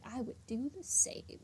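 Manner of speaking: soft conspiratorial whisper; whispered aside. Real, not acted. Streamer joking about Dottore, whispered aside. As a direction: drop to a whisper for the joke.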